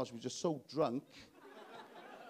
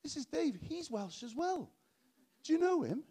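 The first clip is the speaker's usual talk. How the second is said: much higher, sing-song patronising voice; patronising Londoner; 11 semitones higher than usual. Retold or imitated. Londoner introducing another Welshman, high and patronising. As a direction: talk down to him sweetly. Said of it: "This sort of patronising idea".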